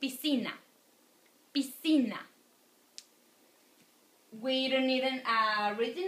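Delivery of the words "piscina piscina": "piscina" with a strong, falling "ci"; next-to-last syllable stressed. Shown as a demonstration. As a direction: punch the CI in "piscina". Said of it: "the strong one is next to last".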